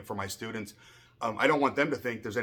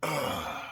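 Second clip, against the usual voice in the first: retold groan; 3 dB quieter than usual. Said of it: "I'm just like".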